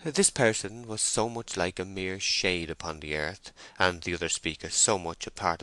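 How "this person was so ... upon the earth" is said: usual speaking voice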